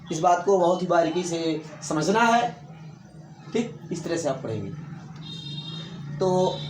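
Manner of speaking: usual speaking voice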